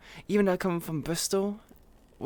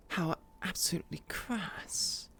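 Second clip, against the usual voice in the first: breathy whisper of disgust; whispered aside; 7 dB quieter than usual. Acted. Whispering to chat, mock appalled. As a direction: hiss it like a shocked snob. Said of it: "skill issue with doing the voice".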